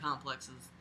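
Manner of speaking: ordinary delivery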